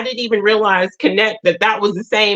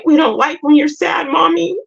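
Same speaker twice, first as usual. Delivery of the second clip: put-on kids' voice; 8 semitones higher than usual. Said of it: "they were like".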